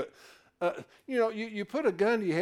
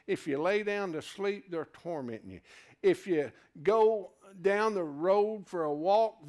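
ordinary talk, nothing marked

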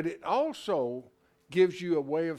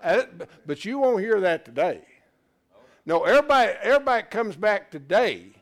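preaching at full force; 3 semitones higher, 7 dB louder than usual. Acted. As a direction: drive the point home to the congregation. Preacher raising the pitch.

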